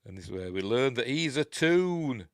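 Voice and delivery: normal talking voice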